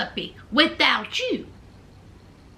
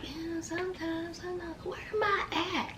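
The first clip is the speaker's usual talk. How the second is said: dazed stoned mumble; 16 dB quieter than usual. Retold or imitated. Puppy answering her friends in a dazed, soft mumble. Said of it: "Puppy was like".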